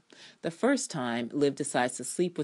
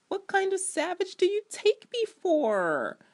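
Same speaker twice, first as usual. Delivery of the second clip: Ponytail's indignant line; 4 dB louder than usual. Retold or imitated. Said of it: "says Ponytail".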